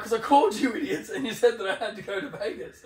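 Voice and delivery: high, loud, fed-up rant; blurting out in frustration; exasperated outburst. Retold or imitated. Arguing with airline desk lady.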